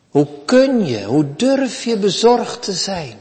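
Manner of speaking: normal talking voice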